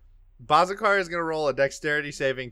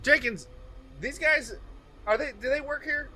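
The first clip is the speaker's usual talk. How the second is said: high-pitched lawman voice; questioning lawman; 12 semitones higher, 4 dB quieter than usual. Acted. Lawman asking Jenkins about two strangers, questioning. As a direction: check with a colleague, pitched way up.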